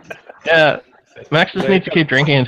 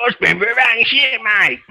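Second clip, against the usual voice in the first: lightly put-on Australian accent, higher; mock Aussie accent; 5 semitones higher than usual. Mocking his drunk mate in an Aussie accent. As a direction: parrot a mate's Aussie twang. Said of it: "You sound like an alien".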